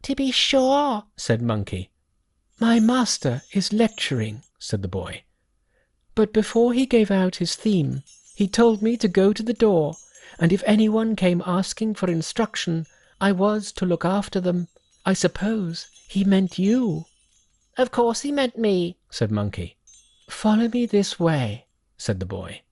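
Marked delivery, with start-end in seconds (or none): 0.0-1.0 high, odd little monkey voice. Acted. 2.6-4.4 put-on high voice of a fairy boy. 6.1-17.0 put-on high voice of a fairy boy. 17.8-19.0 high, odd little monkey voice. Acted.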